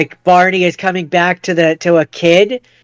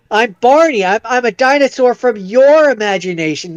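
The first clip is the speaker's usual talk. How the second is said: goofy dinosaur voice, pitched up; higher, earnest cartoon-character voice; Barney; 6 semitones higher than usual. Dinosaur introduces himself to the grown-up hero, goofy Barney voice. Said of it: "with a goofy voice is like".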